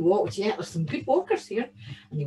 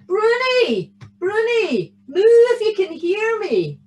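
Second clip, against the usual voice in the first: loud high calling; shouting; 10 semitones higher, 7 dB louder than usual. Acted. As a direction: shout her name far. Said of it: "He shouted for her again".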